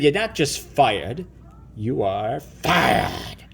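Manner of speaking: very slow, each word weighed; slow, deliberate firing. Acted. Boss toy firing the rival, slow and deliberate. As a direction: spell out the sentence slowly to rub it in. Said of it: "I said".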